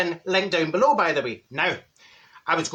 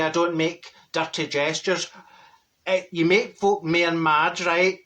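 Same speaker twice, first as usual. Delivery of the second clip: ordinary delivery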